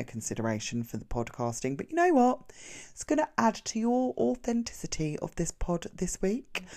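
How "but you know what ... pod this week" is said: bright high playful aside; playful burst. Real, not acted. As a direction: throw it out with a cheeky grin, voice jumping up. Jokey aside about noisy family.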